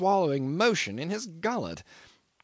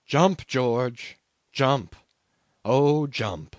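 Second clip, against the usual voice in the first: mild yell at ordinary pitch; understated retold yell; 4 dB louder, much slower than usual. A narrator's reading. Survivor recalls men yelling after a dead man, restrained yell. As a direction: suggest the yelling without fully raising the voice. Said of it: "they were yelling after one dead man".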